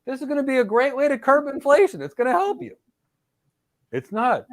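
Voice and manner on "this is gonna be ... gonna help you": much higher, hammed-up politician promise; mock politician. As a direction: sell it like a slick politician you don't believe. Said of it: "Watch these politicians speak".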